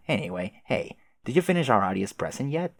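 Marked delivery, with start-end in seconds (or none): none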